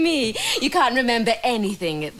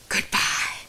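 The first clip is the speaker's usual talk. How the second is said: sly whispered goodbye; 3 dB quieter than usual. Acted. Schemer ending the call.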